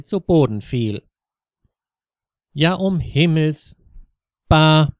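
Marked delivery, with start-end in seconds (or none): 2.5-4.9 loud startled outcry; alarmed cry. A narrator's reading. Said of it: "rief Ingrid".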